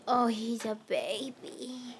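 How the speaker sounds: slow tender coo over the cat. Real, not acted. Cooing at cat.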